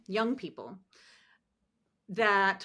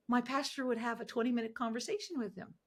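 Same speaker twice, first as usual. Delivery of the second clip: ordinary talk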